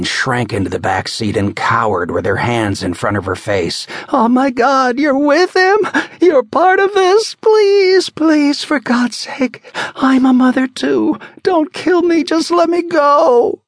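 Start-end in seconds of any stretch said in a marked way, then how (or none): 4.1-13.6 high, loud pleading, slowing at the end; terrified begging. Acted. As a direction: beg for mercy, voice high with fear. Woman begging for her life.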